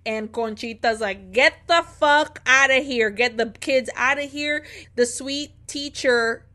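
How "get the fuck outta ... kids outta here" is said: loud urgent yell to get out; retold shout. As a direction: yell at everyone to flee the fire. Conchita screaming at the fire.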